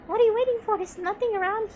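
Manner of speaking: an eager friend pushing to move; impatient urging. Acted. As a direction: push them to go now.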